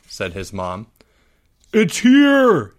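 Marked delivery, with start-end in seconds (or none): 1.7-2.7 much higher, louder excited yell. Acted. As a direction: call out from the window. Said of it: "yelled Albert from the window".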